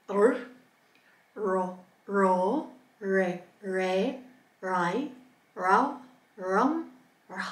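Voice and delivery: "ra, ri, ru" with the tongue drawn back; each "ra" with the tongue not quite touching the roof; tongue-back R drill. Shown as a demonstration. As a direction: drill "rai, rau, rum" on an untrilled, retracted R. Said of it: "So let's try it together".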